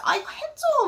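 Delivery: put-on high whiny kid begging "아, 이거 해줘", with a laugh; whining child. Teacher imitating a child whining, high whiny voice. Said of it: "애기들이 막 징징대는 거 있죠".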